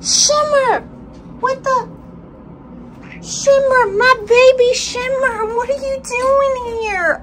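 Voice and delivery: high, loud squeal of disbelief; shocked recognition. Real, not acted. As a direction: cry out to a pet you never expected to see. Shocked to find an adopted cat back.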